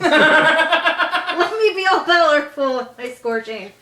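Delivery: slow, drawn-out mighty boast; grandiose boast. Pretend. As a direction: ham up your all-powerful glory. Hammed-up power boast.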